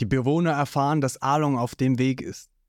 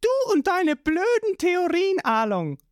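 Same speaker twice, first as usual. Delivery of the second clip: put-on much higher, teasing girl's voice; Nami's high voice. A narrator's reading. Nami mocking Along's theories, in a high voice. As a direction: scold him in a bright high register. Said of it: "Nami kommt angelaufen und sagt".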